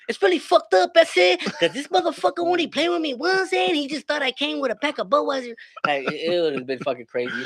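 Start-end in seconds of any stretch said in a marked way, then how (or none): 0.1-5.5 put-on Mexican accent. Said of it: "a guy with a sombrero".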